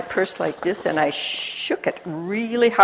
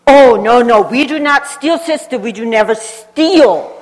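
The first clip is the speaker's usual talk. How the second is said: raised, protesting shopkeeper voice, higher; insistent shopkeeper; 5 semitones higher, 7 dB louder than usual. Retold or imitated. Shopkeeper protesting to the American sister, higher and louder. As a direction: plead innocence earnestly. Shopkeepers deny stealing, acted.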